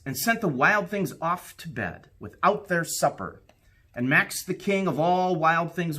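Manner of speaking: put-on lively read-aloud for children, rising with the adventure; storybook narration. A narrator's reading. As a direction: tell it like a bedtime story to a class. Reads picture book aloud.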